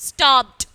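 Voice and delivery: Stopped, falling, with a crisp final T. Shown as a demonstration. Teacher recaps the first rule, Stopped with a T ending. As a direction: say Stopped as "stopt", one loud syllable. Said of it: "E D as T in the word stopped".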